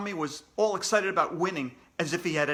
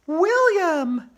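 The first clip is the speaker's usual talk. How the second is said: much higher, loud triumphant shout; 14 semitones higher, 11 dB louder than usual. A narrator's reading. Andrea shouting the final answer, high and loud. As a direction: belt out the answer at last. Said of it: "Andrea shouted".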